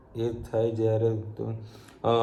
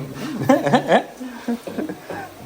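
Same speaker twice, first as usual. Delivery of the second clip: high, bright burst of laughter; 12 semitones higher, 4 dB louder than usual. Real, not acted. Dad laughing about eating on the rooftop, high laugh. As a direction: laugh out at the idea.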